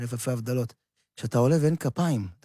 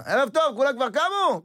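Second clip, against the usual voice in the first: much higher, bright mock opening of a show; stage greeting; 12 semitones higher than usual. Performer greeting the audience from the stage, hammed-up. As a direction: hail the crowd like a showman walking on.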